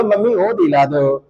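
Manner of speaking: mild mock-crying plea; pretend tearful pleading. Child begging mummy to buy something, pretend tearful pleading. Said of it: "अरे रो कर भी तो बोलो".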